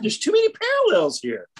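high, excited exclamation of discovery; thrilled realization. Retold or imitated. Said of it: "I just getting excited".

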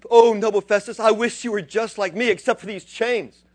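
Paul begs Festus with passion, loud and high; Paul pleading. Retold or imitated. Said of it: "And Paul goes".

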